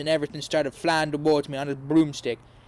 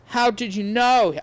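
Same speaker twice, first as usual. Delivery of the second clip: higher, startled question; higher surprised gasp of a question; 7 semitones higher, 4 dB louder than usual. A narrator's reading. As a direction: ask in shock. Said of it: "I asked in a surprised way".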